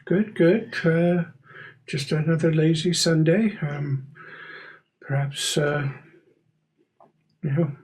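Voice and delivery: lightly voiced neighbor making small talk; Mister Corbett. Neighbor chatting with young Alice at the door, lightly voiced, hesitant.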